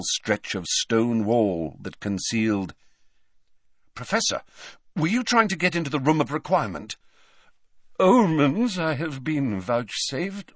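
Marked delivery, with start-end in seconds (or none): 4.0-7.0 urgent question. A narrator's reading. Harry urgently questions the professor. 8.0-10.6 slow, high, portentous seer; lofty, drawn-out mystic; theatrical seer. A narrator's reading. Voices Trelawney in story.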